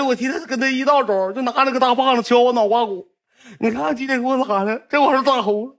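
high, insistent tattling; aggrieved complaint. Retold or imitated. Bullied boy reporting beatings to the bully's grandparents, aggrieved. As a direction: pile up the grievances in a high, pleading pitch.

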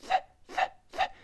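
voiceless "th", tongue tip just past the teeth; soft, separated bursts of air on th. Shown as a demonstration. Teacher shows students the sound, tongue-out th. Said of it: "T H要吐舌头".

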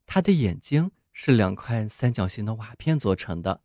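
usual speaking voice

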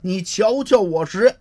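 loud, high, commanding taunt; stern challenge. Acted. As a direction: lord it over him with menace. Master confronts the thug.

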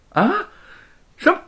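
high, loud stunned disbelief; shocked. A narrator's reading. Wu Cheng'en to departing friends, shocked and loud. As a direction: blurt it out in alarm. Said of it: "吴承恩大吃一惊".

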